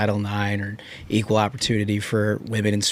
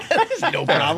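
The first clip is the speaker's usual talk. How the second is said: laughing mock bravado; 9 semitones higher than usual. Mock cocky boss banter.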